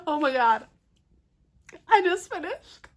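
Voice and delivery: sudden high-pitched excitement; excited gasp. Real, not acted. Reader who just finished the book, high excited squeal.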